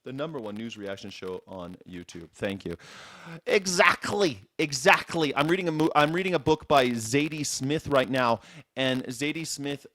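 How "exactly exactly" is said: mock English "exactly", higher pitched; English dialect. Host echoing English novel characters, mock English accent. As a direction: exclaim it in an imitated English accent. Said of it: "he always would say".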